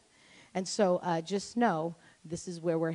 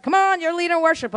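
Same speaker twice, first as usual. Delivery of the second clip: calling out; 8 semitones higher, 8 dB louder than usual. Retold or imitated. Mother calls her to lead worship.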